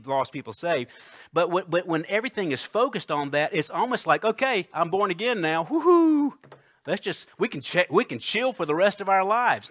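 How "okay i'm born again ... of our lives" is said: higher-pitched mock celebration with a whooped woo-hoo; breezy, hammed-up relief, pitched up; carefree new convert. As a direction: play the convert who thinks it's all done. Acting out a complacent new convert.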